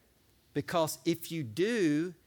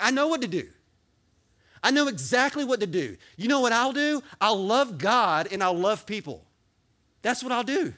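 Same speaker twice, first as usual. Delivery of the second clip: loud, much higher joyful exclaiming; excited believer; 6 semitones higher, 7 dB louder than usual. Pretend. Imagined believer realising what to do, loud and overjoyed. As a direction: burst out like you finally get it. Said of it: "You're gonna be like".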